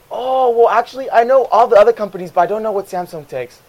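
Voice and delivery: high, loud fake-helpful sarcasm; sarcastic. Cashier to a customer asking about batteries, brightly sarcastic. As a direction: feign cheerful expertise. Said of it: "he didn't catch my sarcasm".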